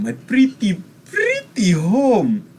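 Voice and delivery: high, slow, loud swooning over home; doting on his castle; gushing. Acted. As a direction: savour each word with delight. Noble gushes over home.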